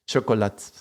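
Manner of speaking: chocolate without its final vowel; Portuguese chocolate, end swallowed. Shown as a demonstration. Showing Portuguese against Spanish, chocolate cut short. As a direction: let chocolate die on the t. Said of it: "they speak chocolate".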